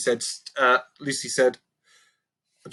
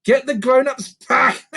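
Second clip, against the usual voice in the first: higher, louder scoff; mocking; 12 semitones higher, 6 dB louder than usual. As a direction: scoff at the idea. Mocking creaker.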